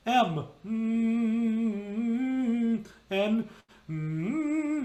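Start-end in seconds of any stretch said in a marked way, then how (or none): 0.6-2.9 long hum on M, higher held pitch; sung M hum. Shown as a demonstration. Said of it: "you can sing it". 3.9-4.8 pitched hum on N; sung N hum. Shown as a demonstration. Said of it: "you can sing it".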